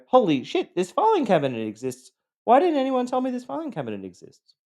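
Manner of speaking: high, loud disbelieving cry; shocked outrage. Retold or imitated. Abuse victim suddenly remembering, high shocked outrage. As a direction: sound betrayed that nobody warned you. Person in conflict realises memories.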